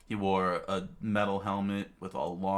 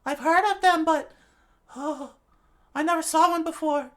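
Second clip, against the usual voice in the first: frightened; 11 dB louder than usual. Acted. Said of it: "shivered in fright".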